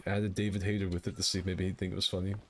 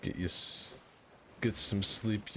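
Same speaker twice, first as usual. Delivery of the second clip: ordinary talk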